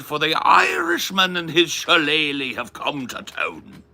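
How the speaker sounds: a jolly Irish town crier gathering the lads; mock Irish accent. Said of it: "You're from Ireland?".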